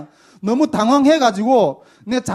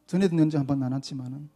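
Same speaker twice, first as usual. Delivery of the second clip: 1 whispered aside; 7 semitones lower, 6 dB quieter, faster than usual. Real, not acted.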